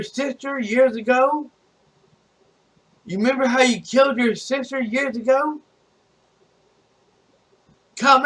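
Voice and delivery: high-pitched confrontational goading; retold challenge, pitch pushed up throughout; acted taunt. Retold confrontation taunting the killer Michael.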